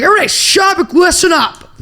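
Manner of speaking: high, forceful shout over a crowd; teacher's shout. Retold or imitated. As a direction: imitate a teacher yelling for order. Teacher silencing crowded hallway.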